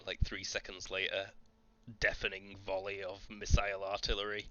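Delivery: usual speaking voice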